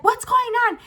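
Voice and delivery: very high questioning voice; man's wife. Retold or imitated. Wife coming from the back, high demanding question. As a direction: imitate a wife demanding answers. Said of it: "And she's like".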